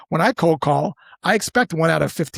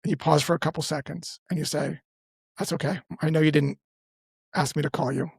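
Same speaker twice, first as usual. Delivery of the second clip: calm, dropped-back voice; 5 dB quieter than usual. Shown as a demonstration. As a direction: drop your voice and take the pressure off. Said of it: "you just drop back".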